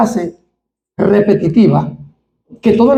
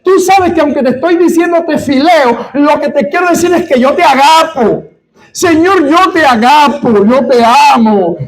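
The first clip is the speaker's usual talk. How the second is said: fearful pleading; 5 semitones higher than usual. Retold or imitated. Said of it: "lleno de temor".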